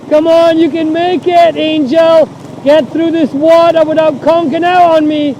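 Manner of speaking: loud, high urging of the bike; urging shout. Real, not acted. Urging bike through water.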